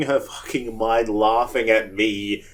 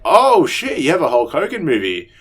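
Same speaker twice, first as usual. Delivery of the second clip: excited quote; 4 semitones higher, 5 dB louder than usual. Retold or imitated. Excited reaction quoted in story.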